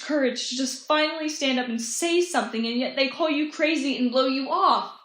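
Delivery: pained, impassioned protest; anguished outburst. Acted. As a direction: build up hurt indignation and let it pour out. Monologue outburst about not being believed.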